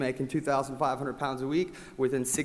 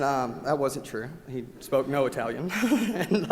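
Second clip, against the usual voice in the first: ordinary delivery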